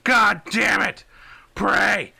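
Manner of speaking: high, very loud desperate scream; screaming over comms. Acted. Soldier screaming over garbled comms to the squad, frantic high yell. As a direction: scream it into a distorting radio. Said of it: "someone with a lot of audio distortion just screaming".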